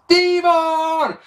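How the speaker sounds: high, loud mock yell of Devon; mock shout. Bubba yelling for his brother to get the tables. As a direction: call out for your tag partner. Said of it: "and shouts".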